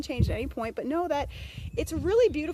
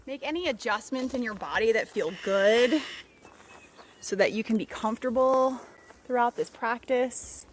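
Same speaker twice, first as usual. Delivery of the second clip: unhurried meditation voice with long pauses; guided meditation. Real, not acted. Guide leading listeners through a body scan, calm meditative voice. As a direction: ease them through it, phrase by phrase.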